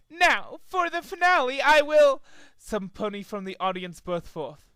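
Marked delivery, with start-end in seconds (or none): 0.1-2.2 high, loud grand announcement; showman Trixie. A narrator's reading. Trixie announcing the finale, high and grand. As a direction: play to the crowd.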